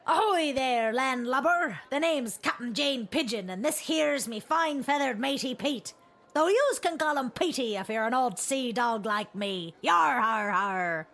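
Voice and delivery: put-on gruff old fisherman pirate; hearty sea dog growl. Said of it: "'Tis a pirate tongue what fills me gob".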